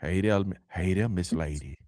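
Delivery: very low, hushed put-on voice; Huggy Beard. Host showing off his impression to chat, low hushed character voice. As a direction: murmur smooth greetings way down low. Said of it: "How you like my Huggy Beard voice?".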